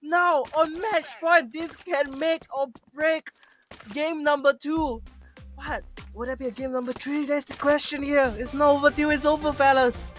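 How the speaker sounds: high, slow, hyped recap; esports caster. Real, not acted. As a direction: savor the clutch play slowly.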